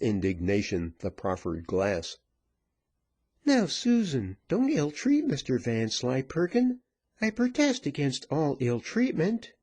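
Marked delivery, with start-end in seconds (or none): 3.4-9.5 high-pitched woman's protest; high, admonishing character voice; prudent peacemaker. A narrator's reading. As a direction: object primly in a high voice.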